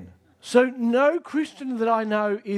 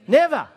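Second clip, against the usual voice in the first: Never punched out, louder; stress on NEVER; 4 semitones higher, 7 dB louder than usual. Shown as a demonstration. Preacher answering his congregation's question, punching Never. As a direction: hit Never hard as the answer. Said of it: "how, how often?".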